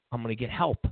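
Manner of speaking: usual speaking voice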